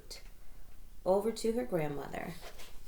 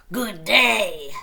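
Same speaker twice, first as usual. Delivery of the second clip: wolf growl; 2 semitones higher, 11 dB louder than usual. Acted. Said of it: "growled the wolf".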